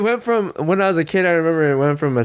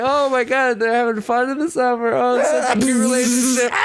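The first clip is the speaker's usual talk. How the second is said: gushing viewer; 13 semitones higher than usual. Retold or imitated. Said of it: "you're just like".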